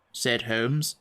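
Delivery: usual speaking voice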